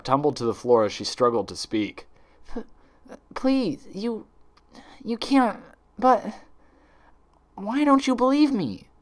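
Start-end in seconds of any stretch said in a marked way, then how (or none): 2.5-8.9 high, broken, frightened stammer; stammering plea. Acted. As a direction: stumble over every word in panic. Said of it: "she stammered".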